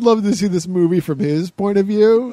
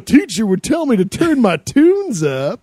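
put-on excited kid; 7 semitones higher, 5 dB louder than usual.

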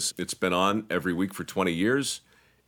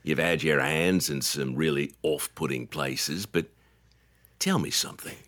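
Australian DJ; 4 semitones lower than usual. Retold or imitated. Imitating an Australian radio host, low Australian accent. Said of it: "What you hear in Melbourne is".

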